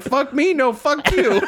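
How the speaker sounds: retelling a friend's outburst at a teammate, mock furious; mock angry retort, high and laughing.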